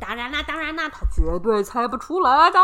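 gleeful taunting monster; smug creature teasing. Acted.

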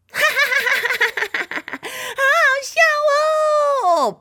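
very high, loud hysterical laughing; high squeaky giggle of the little sister; shrill cackling laugh. Acted. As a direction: laugh your head off in a squeaky voice. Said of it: "又有一个声音".

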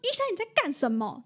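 half-acted outrage at the vet; imitating angry owner. Retelling the owner confronting the vet, mildly mock-angry. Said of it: "有点生气".

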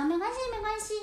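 high, bright reassurance; cheerful penguin. Acted. Little penguin reassuring the cats, high and kind. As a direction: wave it off kindly in a high voice.

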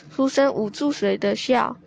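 plain reading voice

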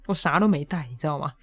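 ordinary talk

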